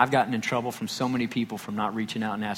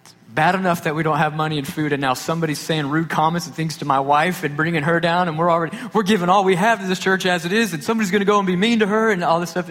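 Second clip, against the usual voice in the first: rising louder, higher, faster protective anger; indignant outrage; 7 semitones higher, 8 dB louder than usual. Retold or imitated. Dad imagines someone hurt his wife, loud indignant rant. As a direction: build up the outrage on his wife's behalf. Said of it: "my dad's first thought is".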